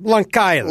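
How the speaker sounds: "Long Island" as Lonk-Island, hard K. Shown as a demonstration. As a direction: click a K onto the end of Long in "Long Island". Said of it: "They put a K in it".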